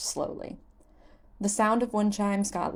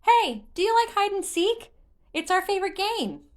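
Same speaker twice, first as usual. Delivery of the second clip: high, loud, eager invitation; a kid begging someone to play; eager child; 13 semitones higher, 5 dB louder than usual. Acted. Korok inviting Link to hide and seek, high eager voice.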